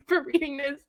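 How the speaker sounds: ordinary delivery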